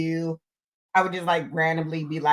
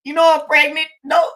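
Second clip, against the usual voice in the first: higher, louder half-joking scold; mock scolding; 8 semitones higher, 6 dB louder than usual. Pregnant mother retelling how she scolded her son for crying, loud and high. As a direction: playfully tell him off. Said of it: "I was like".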